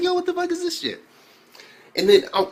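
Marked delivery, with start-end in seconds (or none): 0.0-1.0 high-pitched incredulous yelp; acted bafflement. Reviewer reacting to a giant foot breaking in, acted bafflement. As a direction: act out being floored, pitched way up.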